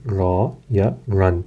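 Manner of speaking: را and رن said slowly with a full heavy r. Shown as a demonstration. Teacher showing students the heavy ra, slowly. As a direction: draw out را then رن with a thick r. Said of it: "موٹی پڑھی جائے گی".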